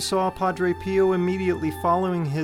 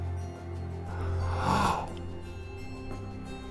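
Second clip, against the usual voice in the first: sharp gasp; 12 dB quieter than usual. Acted. Sees the wounds of Christ.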